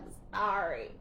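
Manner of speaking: emotional apology. Real, not acted. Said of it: "I'm getting emotional right now".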